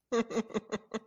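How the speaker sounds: soft, stifled snicker; little snicker. Acted. Gwendolyn overhearing the exchange, a little snicker. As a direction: snicker quietly to yourself. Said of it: "gives herself a little snicker".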